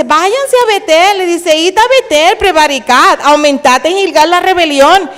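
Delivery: loud, mocking proclamation of the verse; sarcastic scripture reading. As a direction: read it as biting irony. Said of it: "Eso es hablar con sarcasmo". (lectura sarcástica y fuerte)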